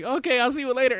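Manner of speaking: high, flustered brush-off; imitated nervousness. Landlord ending the chat with his tenant, mock nervous. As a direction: hurry out of it, mock embarrassed. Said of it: "nervous, but, like, embarrassed".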